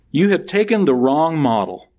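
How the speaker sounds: preaching at full force. Acted. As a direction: build the rebuke until it rings. Preaching Jesus' rebuke to disciples.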